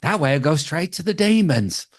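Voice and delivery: a hint of a squeaky worm giving a friendly warning; William the Worm. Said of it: "waiting for William the Worm to come out".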